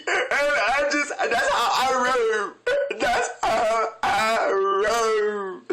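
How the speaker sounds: slow, drawn-out mock wail; mock sobbing tail. Host still mocking Kandi's tearful line, slow mock wail. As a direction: drag it out like a blubbering hammed-up cry. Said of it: "she starts crying".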